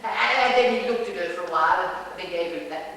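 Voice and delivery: ordinary delivery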